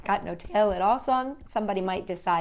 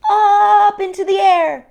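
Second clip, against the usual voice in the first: high, loud soaring narration; triumphant; 10 semitones higher, 10 dB louder than usual. A narrator's reading. Narrator as the kite finally flies, high and loud. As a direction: ring it out in triumph.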